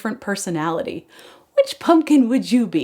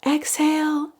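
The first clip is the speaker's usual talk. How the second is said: ordinary delivery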